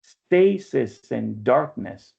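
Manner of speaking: Stasis and darkness said slowly, each syllable weighed; slow scansion read. Shown as a demonstration. Teacher confirming the student's scansion, slow and deliberate.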